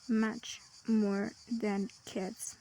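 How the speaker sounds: slow, drawn-out model phrase; slow, deliberate example. Shown as a demonstration. Teacher modelling a phrase to students, slow and clear. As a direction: spell out each word slowly for learners. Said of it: "is British".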